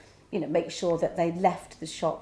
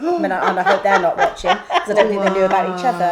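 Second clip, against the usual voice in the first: laughing; 8 dB louder than usual. Real, not acted. Laughing at scandalous story.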